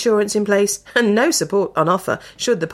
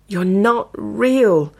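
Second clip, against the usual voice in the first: whispered; 3 semitones higher, much slower than usual. A narrator's reading. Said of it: "Edmund whispered".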